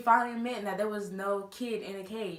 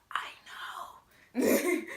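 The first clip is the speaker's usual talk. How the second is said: whispered reply; 6 semitones higher than usual. Retold or imitated. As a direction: whisper back in agreement.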